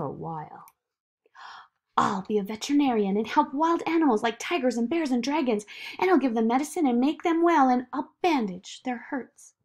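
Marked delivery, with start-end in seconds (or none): 2.0-9.5 put-on higher, eager child's voice; Zoe, confident child. Zoe answering the doubting voice, eager and sure. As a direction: brush off the doubt and run on excitedly.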